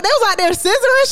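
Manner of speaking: high, incredulous question; high disbelieving gasp of a question; shocked question. Retold or imitated. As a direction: squeal it in disbelief. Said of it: "I was like".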